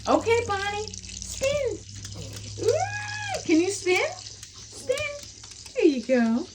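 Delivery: very high, sing-song commands; urging Bonnie to spin in a squeaky high voice; dog talk. Real, not acted.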